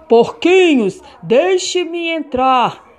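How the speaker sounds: slow, loud wolf call. Acted. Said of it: "o lobo gritou".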